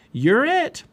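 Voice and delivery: high, loud, rising game cry; playful call. A narrator's reading. Girl tagging a cub in play, playful call. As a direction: sing it out mid-chase.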